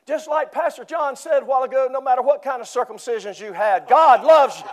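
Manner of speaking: high, loud, eager announcement; an eager elder getting the words wrong in full voice; excited elder. Retold or imitated. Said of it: "he's all excited".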